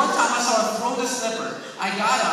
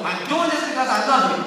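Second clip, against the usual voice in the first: stern loud dad; 6 semitones higher, 8 dB louder than usual. Retold or imitated. Dad while spanking.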